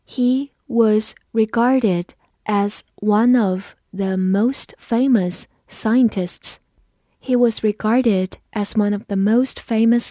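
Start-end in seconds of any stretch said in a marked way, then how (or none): 0.1-6.6 very slow, word by word; slow read-along. Shown as a demonstration. Teacher leads learners repeating after her, slow read-along. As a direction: pause after each word so learners can follow. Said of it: "跟着我慢读一遍".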